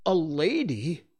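slow, high, stunned question; disbelieving and rising; shocked. A narrator's reading. Toodles trembling in shock.

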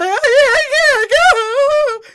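high, loud, drawn-out wail; hammed-up hysterical sobbing whine; mock hysterical. Mom imitates daughter fighting the pants, mock hysterical. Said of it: "she's just hysterical at this point".

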